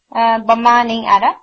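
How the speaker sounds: ordinary talk, nothing marked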